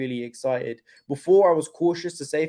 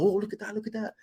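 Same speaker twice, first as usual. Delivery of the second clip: high, hushed mock-excited mum; 7 semitones higher, 6 dB quieter, faster than usual. Streamer mimics mum spotting a green line, high excited mum. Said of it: "she's like".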